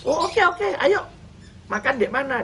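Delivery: high, quick delighted yes; surprised eager reply. Retold or imitated. Preacher retelling his surprised answer to a stranger's call, high and eager. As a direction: jump at the invitation, way up high.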